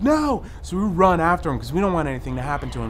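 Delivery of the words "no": high loud "No"; alarmed shout. Retold or imitated. Friends calling after fleeing Guy, high alarmed shout. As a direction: call after him in alarm. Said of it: "And we're like".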